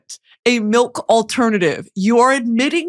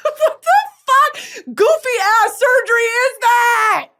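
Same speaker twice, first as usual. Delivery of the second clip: outraged disbelief; 12 semitones higher, slower than usual. Real, not acted. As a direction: yell it in comic disbelief. Outraged rant about surgery.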